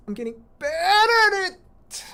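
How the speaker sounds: high, loud, stretched-out cry of glee; gleeful cry. Real, not acted. Gamer to chat while playing, a high, loud, drawn-out cry of glee. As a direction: sing it out in triumph. Player cheering own improvement.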